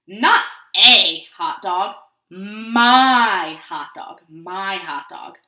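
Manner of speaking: slow, emphatic correction, MY stretched long; possessive, drawn-out "my"; very annoyed pigeon. A narrator's reading. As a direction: correct the duckling crossly, word by word. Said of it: "he's very annoyed".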